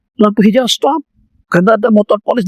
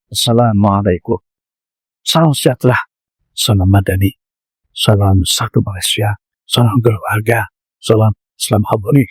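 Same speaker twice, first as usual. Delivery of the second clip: mock greetings; 9 semitones lower than usual. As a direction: lower voice rattling off greetings. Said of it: "Keluar terus".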